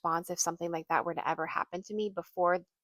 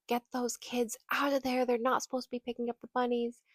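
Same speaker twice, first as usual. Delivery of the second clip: higher-pitched imitation of a yell; mock yelling; 5 semitones higher than usual. Woman yelling over the fence at a mother, mock yell. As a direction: retell her scolding order in a raised pitch. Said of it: "she's yelling".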